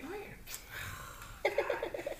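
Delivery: breathy high laugh burst; real laugh. Real, not acted. Laughing at own [name] impression.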